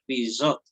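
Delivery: bizot with the shva dropped, straight from "bi" to "zot"; demonstrated pronunciation. Shown as a demonstration. Teacher showing the lost shva, careful two-syllable bizot. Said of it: "se va a pronunciar".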